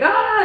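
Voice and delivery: a high, loud delighted "ah"; excited gasp. Retold or imitated. Vlogger retelling her joy on opening the box, loud excited gasp. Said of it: "I was like".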